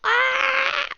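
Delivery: high, sharp puppy yelp. Retold or imitated. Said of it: "the puppy is like".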